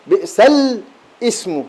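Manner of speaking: بئس الاسم drawn out slowly, with the hamza and vowel on الاسم clear; teacher's slow model reading of بئس الاسم; slow sounding out. Shown as a demonstration. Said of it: "هنا سكون وهنا همزة".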